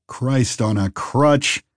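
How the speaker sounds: slow, drawn-out exasperation; lightly voiced gruff lumberjack; exasperated lumberjack.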